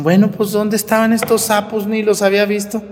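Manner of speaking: high, surprised exclamation; mock surprise. Priest imitating folk startled by toads, high surprised voice.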